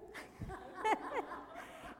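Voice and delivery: high giggling laugh; amused laugh. Real, not acted. Speaker tickled by the child's blunt question, high laugh. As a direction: laugh at your own story. Tickled by her own story.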